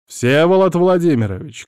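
loud call into the room; shout. Acted. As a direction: call out a name loudly. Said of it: "крикнул я". (громкий крик)

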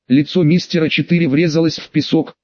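ordinary delivery, nothing marked

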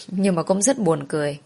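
plain reading voice

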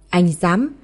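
ordinary delivery, nothing marked

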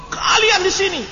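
hammed-up accusation breaking into fake bawling; campfire indoctrinator. Tutor shaming students for not studying, overacted cry. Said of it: "nangis sama-sama".

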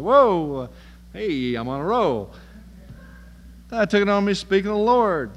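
pumped up like a dealer closing in; excited bargaining. Retold or imitated. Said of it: "Abraham answered and said".

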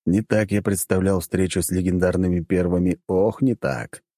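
usual speaking voice

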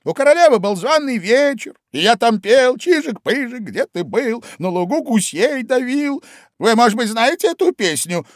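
high, loud, lively Hatter; the Hatter spinning his tale with gusto. A narrator's reading. Hatter tells his story.

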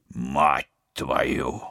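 a curse that slips out; blurted curse. Acted. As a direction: blurt it out in shock. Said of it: "вырвалось у Вадима".

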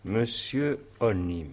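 very slow, drawn-out "Monsieur Onim"; pondering. Acted. Judge repeating the mysterious name, slow and pondering. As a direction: weigh the name syllable by syllable.

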